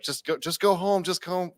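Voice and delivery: high, repetitive mock pleading; high retold urging. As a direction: imitate a bouncer shooing someone off. Bouncer urging kid away, retold.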